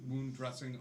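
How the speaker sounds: ordinary delivery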